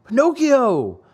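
high call across the road. A narrator's reading. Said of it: "Lampwick called out".

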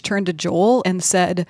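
usual speaking voice

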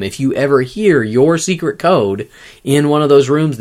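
normal talking voice

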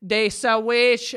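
put-on slow, mangled French with an American accent; an American tourist fumbling a French order in an American accent. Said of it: "putting it in, like, an American accent".

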